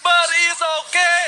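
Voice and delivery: slow, loud drawn-out shout. Real, not acted. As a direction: belt it out to the crowd. Caster shouts over play.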